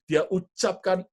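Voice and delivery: normal talking voice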